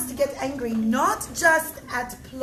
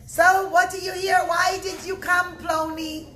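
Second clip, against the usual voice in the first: loud, higher challenge from soldiers; loud demand; 6 semitones higher, 6 dB louder than usual. Acted. Soldiers questioning Bar Kamsa at the gate, loud demand. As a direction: bark the question at the stranger.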